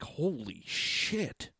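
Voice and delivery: slow, drawn-out disbelief; retold shock. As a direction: stretch it out, mildly stunned. Retelling own shocked reaction.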